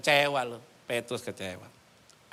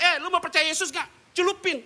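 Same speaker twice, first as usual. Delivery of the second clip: mocking a pushy preacher; 5 dB louder than usual. Preacher mocks pushy evangelist.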